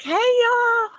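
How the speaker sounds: high East Texas drawl greeting; East Texas accent. Retold or imitated. Woman greeting her old girlfriends, East Texas accent. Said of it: "listen to her accent".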